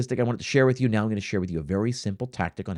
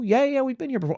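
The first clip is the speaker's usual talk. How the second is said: diner reply; 13 semitones higher than usual. Retold or imitated. Diner answers the server.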